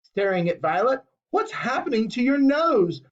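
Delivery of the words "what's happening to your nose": high, alarmed question; alarmed cry. A narrator's reading. Mother alarmed at her daughter's changing nose. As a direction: gasp it out in fright. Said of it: "shrieked Missus Beauregard".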